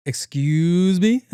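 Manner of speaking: loud, drawn-out, climbing high; mock indignation. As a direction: stretch it out in exaggerated offense. Acted indignant reply to staring stranger.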